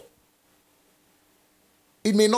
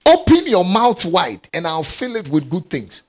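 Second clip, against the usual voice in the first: proclaiming; 4 semitones higher, 5 dB louder than usual. Acted. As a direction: call it out like a command from the pulpit. Preacher repeats verse.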